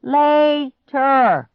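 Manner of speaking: loud, high drawn-out call; yelling. A narrator's reading. Clark yelling back to Grandpa through cupped hands. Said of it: "cupped his hands and yelled".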